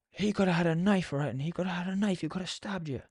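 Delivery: high-pitched manager's telling-off; retold shouting. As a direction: imitate an agitated boss shouting. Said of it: "he started shouting at me".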